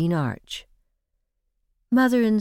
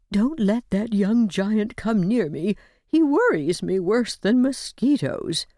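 put-on old woman whispering; 3 semitones higher than usual. A narrator's reading. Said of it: "whispered the old lady to Amy".

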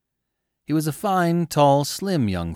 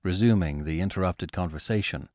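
plain reading voice

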